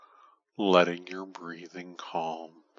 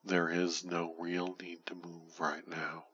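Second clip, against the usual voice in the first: ordinary talk, nothing marked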